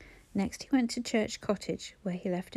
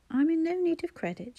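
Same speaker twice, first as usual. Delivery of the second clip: higher, curt and cold; brisk and hostile; 8 semitones higher than usual. A narrator's reading. Housekeeper brushes off praise to show disapproval, brisk and hostile. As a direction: cut him off, unfriendly. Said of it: "she said briskly, making her tone hostile".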